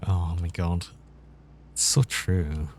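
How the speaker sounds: usual speaking voice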